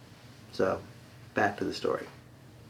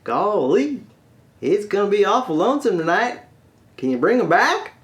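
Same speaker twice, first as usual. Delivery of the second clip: high, plaintive Arkansas twang; Arkansas accent; 8 semitones higher, 3 dB louder than usual. Acted. Lonesome Arkansas man begging the genie, high pleading Arkansas accent. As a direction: plead like a simple Arkansas country boy. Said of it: "one guy left from Toadstock, Arkansas".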